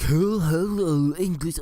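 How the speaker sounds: high, loud mock French tourist; hammed-up confused foreigner; imitating French skiers. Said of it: "they were like".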